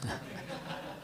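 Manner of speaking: low-key, quiet laugh; light, quiet chuckling; soft chuckle. Real, not acted. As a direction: chuckle softly at your own joke. Joking about favorite place.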